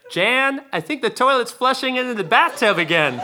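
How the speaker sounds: high Southern granny call from upstairs; grandma yelling down the stairs, Southern accent. Retold or imitated. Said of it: "my grandma yelling".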